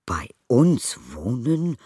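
slow alarmed echo; anxious echo. A narrator's reading. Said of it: "wiederholte Mister Brown ängstlich".

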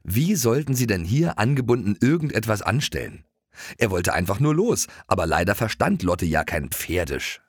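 plain reading voice

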